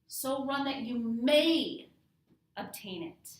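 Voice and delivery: MAY stretched loud and high, then a pause; stress on MAY. Shown as a demonstration. Teacher emphasises each word in turn, stressing MAY. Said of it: "do emphasis on each run, on each word".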